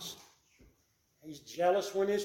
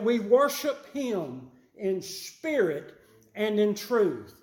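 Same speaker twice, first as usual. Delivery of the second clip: preaching at full force; 4 semitones higher than usual. Acted. Preacher exhorting the congregation, raised and driving. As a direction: preach it out with rising fervour.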